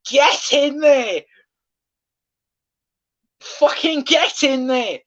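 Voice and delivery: high, slow roar of joy; ecstatic goal celebration. Real, not acted. Fan roaring at the Liverpool goal, ecstatic shout. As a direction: bellow it like your team just scored.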